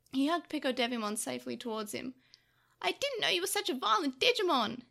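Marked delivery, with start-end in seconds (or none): 2.8-4.8 higher, reproachful scolding; strong disapproval. A narrator's reading. Takeru shields Devimon and scolds Tokomon, strongly disapproving. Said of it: "strong disapproval in his voice".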